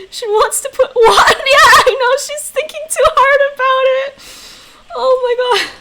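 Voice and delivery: high, loud, laughing through the words. Real, not acted. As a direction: giggle through it. Laughing at a failed try.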